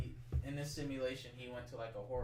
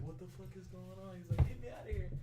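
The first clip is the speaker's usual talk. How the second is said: mock panic; 7 semitones higher, faster than usual. Trapped man panicking in retelling.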